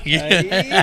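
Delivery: ordinary delivery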